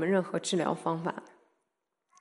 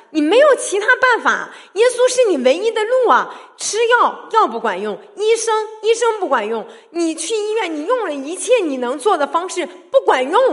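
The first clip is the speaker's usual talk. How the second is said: loud, high, impassioned preaching; preaching at full force; 11 semitones higher, 9 dB louder than usual. Acted. Preacher to congregation about trusting Jesus, loud fervent preaching. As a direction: drive it home to the congregation.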